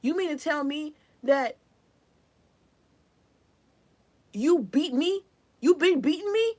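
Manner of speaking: high, loud, indignant mock questioning; imitated mad disbelief. Acting out a person so mad at being beaten, incredulous and loud. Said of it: "this person was so mad".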